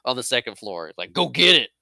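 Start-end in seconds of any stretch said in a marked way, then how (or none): 1.1-1.7 louder, higher mocking shout; taunting yell. Bully taunting the kids, loud mock yell.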